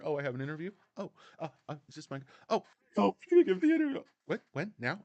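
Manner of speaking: mock flustered celebrity, jumping up high; put-on voice leaping into a high squeal; Meghan Markle. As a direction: feign surprise in halting little bursts. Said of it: "than Meghan Markle".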